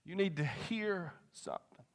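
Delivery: slow, softened repeat with a pause before the last word; imitating preacher. Preacher to one person in the crowd, slow and hushed. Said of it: "this is what he does".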